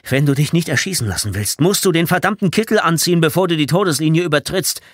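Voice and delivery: loud, high, urgent warning; panting. A narrator's reading. Tommy warning Scott about the smock after running, panting. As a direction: gasp it out after a sprint. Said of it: "antwortete Tommy keuchend".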